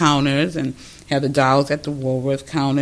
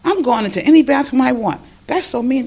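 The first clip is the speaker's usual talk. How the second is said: put-on higher, fast, outraged kid voice; lightly voiced outraged youngster; indignant kids; 7 semitones higher than usual. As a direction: play the kid who's shocked by it.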